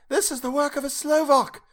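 put-on high, loud cry in an uncertain accent; women crying out. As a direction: cry out like a horrified crowd of women. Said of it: "the women crying out".

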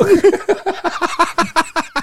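squealing laugh; high-pitched laugh. Real, not acted. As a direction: burst into a shrill laugh. Laughing at partner's answer.

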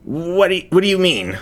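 cornered thief asking the unseen voice, high and jittery; nervous stammer. Acted. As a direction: panic creeping into the bravado.

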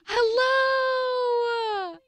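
long, high drawn-out hello; excited greeting. Real, not acted. Host welcoming a guest to the podcast, excited. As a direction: call it out high and stretched.

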